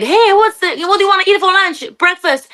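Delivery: high-pitched, eager mock holler; re-enacted too-loud chatter. Wife asking her partner about lunch, re-enacted too-loud chatter. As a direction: call it out much too high and keen. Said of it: "You're screaming".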